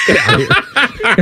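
laughing. Real, not acted. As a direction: burst out laughing. Laughing through the line.

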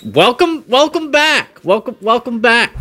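high, hammed-up Southern welcome; mock Southern accent. Co-host teasingly imitating the host's Southern voice.

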